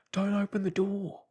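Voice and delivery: frightened man screaming not to open the door; screamed. Acted. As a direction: scream it in panic. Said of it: "he screamed".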